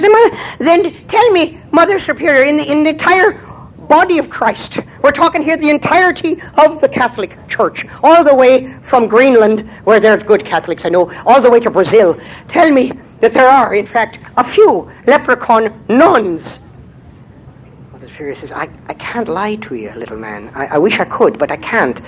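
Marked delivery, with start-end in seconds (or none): 0.0-16.6 pleading leprechaun. Acted. 18.5-22.0 soft nun. Acted. Nun answers softly.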